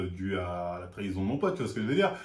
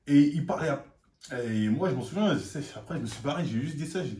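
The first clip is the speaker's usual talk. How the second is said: ordinary talk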